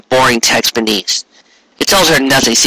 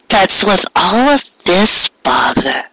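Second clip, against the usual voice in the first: high and a little slow, teasing; a playful mare poking fun at the fuss; light mare voice; 7 semitones higher, slower than usual. Acted. Mare's voice in dialogue.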